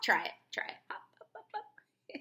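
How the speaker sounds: whispered encouragement. Acted. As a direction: coax them in a whisper. Coaxing kids to hop.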